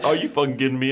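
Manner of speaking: exasperated disbelief. Retold or imitated. Drunk caller finding the wrong number again, mock exasperated. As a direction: throw up your hands. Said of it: "He's like".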